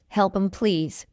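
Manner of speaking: quick, linked "Help 'em, please"; fast English. Shown as a demonstration. Teacher trains learners' ears, fast. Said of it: "fast English is not difficult".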